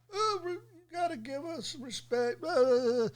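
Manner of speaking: mocking whine. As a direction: sneer at their demand in a high voice. Mocking a group's demand.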